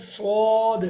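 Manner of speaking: "स्वाद" said heavy, with rounded lips and a loud falling tone; a full-mouthed, heavy "स्वाद"; heavy letter demo. Shown as a demonstration. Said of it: "स्वाद is heavy". (भारी, गोल होंठों से "स्वाद")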